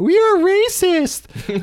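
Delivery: high, slow mock accusation; hammed-up finger-pointing outcry; mock accuser. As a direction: drawl it in a high put-on voice. Imagined tweeter accusing them.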